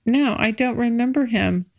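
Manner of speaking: usual speaking voice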